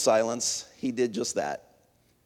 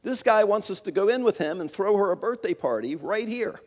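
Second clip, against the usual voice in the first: much higher, excited call to the back room; excited shout, pitched way up; shouting to his wife; 9 semitones higher than usual. Retold or imitated. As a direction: yell it out eagerly to the kitchen. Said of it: "he shouted".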